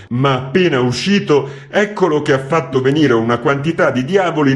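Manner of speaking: loud, high pleading complaint; contrite typesetter. A narrator's reading. Typesetter excusing himself to the editor, loud and pleading. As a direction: plead your innocence, aggrieved.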